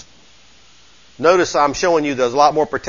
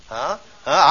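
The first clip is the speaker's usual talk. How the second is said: ordinary talk, nothing marked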